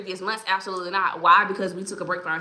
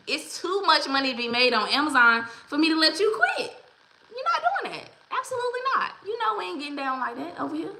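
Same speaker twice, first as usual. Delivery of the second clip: high-pitched, incredulous scolding; playful refusal; 8 semitones higher than usual. Real, not acted. Host to a discouraged viewer, mock scolding. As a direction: refuse to let them quit, laughing into it.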